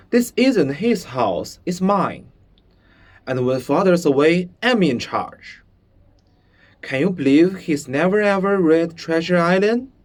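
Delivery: put-on a boy insisting he's in charge; bossy boy. Bruno boasting he's in charge.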